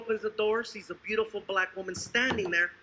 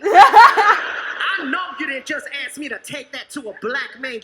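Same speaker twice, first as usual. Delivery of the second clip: loud indignant outburst; 12 dB louder than usual. Acted. Woman voiced, outraged.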